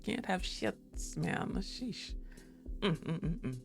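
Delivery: ordinary delivery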